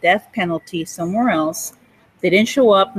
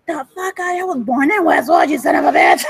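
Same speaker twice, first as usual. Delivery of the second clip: higher, shouting, half laughing; mock outraged shout; 4 semitones higher than usual. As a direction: yell it like you're insulted and loving it. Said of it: "I'm like".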